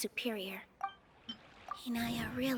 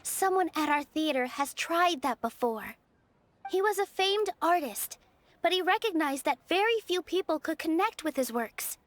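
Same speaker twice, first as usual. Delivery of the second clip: louder, fervent debate voice; passionate rebuttal; 4 semitones higher, 6 dB louder than usual. Acted. Nilou answering the scholar in debate, loud and impassioned. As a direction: stand your ground and push back hard.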